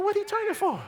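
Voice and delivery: high, hushed little question; small, high voice of dawning dread; mock worry. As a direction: squeak it softly in comic alarm. Retells dread at the turn.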